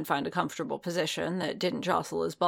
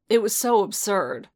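usual speaking voice

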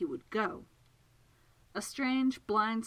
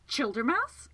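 higher, rising question full of outrage; outraged demand; 6 semitones higher, 4 dB louder, slower than usual. Acted. Segundus finds Childermas fallen into his dream, outraged. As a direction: demand it, pitch lifting in affront. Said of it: "Segundus demanded, outraged".

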